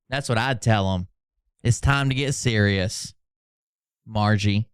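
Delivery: ordinary talk, nothing marked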